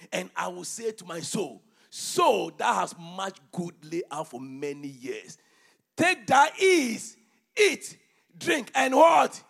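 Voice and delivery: loud, high, slow scripture reading from the pulpit; preaching at full force. Acted. Preacher reading Haggai aloud to his church, booming. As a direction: thunder the prophet's words over the congregation.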